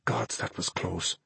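slow, unvoiced whisper. A narrator's reading. Ben to Naomi after a close call, whispered. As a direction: whisper it with relief. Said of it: "Ben whispered back".

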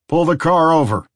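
loud, echoing police order; loudspeaker command. Acted. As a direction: bark it through a PA. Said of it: "a voice echoed behind them".

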